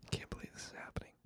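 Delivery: barely audible, quick whisper; mock-stunned whisper. Podcaster to himself after the mob threat, stunned whisper. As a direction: whisper it under your breath.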